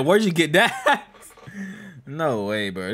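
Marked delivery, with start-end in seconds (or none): 0.1-1.0 mimicking the character. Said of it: "He said".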